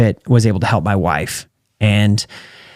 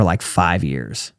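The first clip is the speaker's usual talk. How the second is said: slow and much softer, drawn out "like, five years"; slow, quiet emphasis; 6 semitones lower, 6 dB quieter, much slower than usual. Real, not acted. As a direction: let "like, five years" sink low and slow. Crashed and burned for years.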